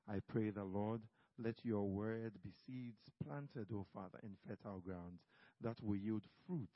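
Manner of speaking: low, hushed prayer voice. Acted. Closing prayer.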